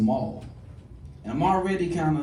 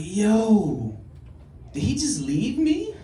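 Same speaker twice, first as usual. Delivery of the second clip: high, loud, slow outraged squeak; a friend's sudden abandonment, cried out in shock; betrayed disbelief; 8 semitones higher, 5 dB louder, much slower than usual. Retold or imitated. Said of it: "And I'm like".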